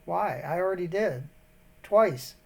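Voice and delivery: ordinary talk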